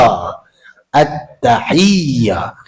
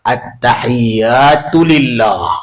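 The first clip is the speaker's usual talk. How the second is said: slow recitation; 3 semitones lower, much slower than usual. Shown as a demonstration. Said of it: "Cukup memadai [name]".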